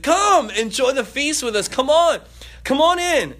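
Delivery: high, loud, coaxing invitation; a father urging his son to join the feast, pleading; father calling son in. Acted. Father begging son to come in, loud.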